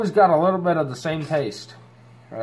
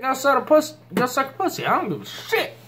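high, heated rant; 12 semitones higher than usual. Real, not acted. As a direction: pitch shoots up, daring them. Defiant rant at offended viewers.

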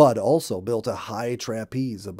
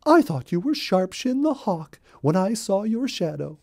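high, loud rabbit voice, still shaken; startled little rabbit; 9 semitones higher, 4 dB louder than usual. A narrator's reading. Molly telling Bluebird she took him for a hawk, high shaken voice. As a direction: explain the fright in a high rabbit voice. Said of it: "exclaimed Molly".

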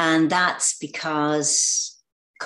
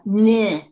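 put-on short, loud childish jeer; a raised, louder mocking noise; taunting "neh"; 5 semitones higher, 6 dB louder than usual. Actress imitating the sting in his interview, mock taunt. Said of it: "it was kind of a".

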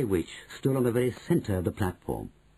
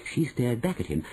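usual speaking voice

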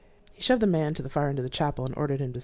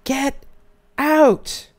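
very high, very loud scream; 12 semitones higher, 11 dB louder than usual. Acted. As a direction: scream it with everything. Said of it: "I screamed".

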